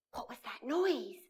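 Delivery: scared whisper. Acted. Narrator whispers to children after a strange noise. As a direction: hush it like something is near.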